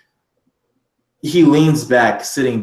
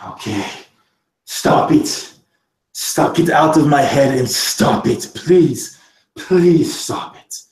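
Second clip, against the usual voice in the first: breathy start, then higher, desperate begging; sputtering plea; 3 semitones higher, slower than usual. Acted. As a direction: beg brokenly, drawing out the last please. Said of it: "He sputters".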